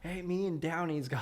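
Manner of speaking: lightly put-on dopey voice; mocking a Bigfoot vlog character; imitating a character. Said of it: "a Down syndrome guy's like".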